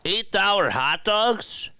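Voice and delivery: high-pitched indignant disbelief; hammed-up incredulous protest; mock outrage. Mock outrage at ballpark prices.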